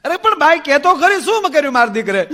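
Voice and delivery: hammed-up anxious old mother; pleading mother-in-law. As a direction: beg her to tell what happened.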